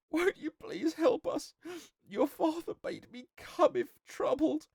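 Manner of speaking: put-on very high, tearful young woman; sobbing. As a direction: plead through heartbroken sobs. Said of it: "sobbing as though her heart would break".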